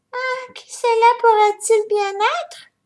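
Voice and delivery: high, slow, little bear voice; small cub asking, high and slow; Baby Bear wondering. A narrator's reading. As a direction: wonder aloud like a tiny cub. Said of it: "se demanda Bébé Ours".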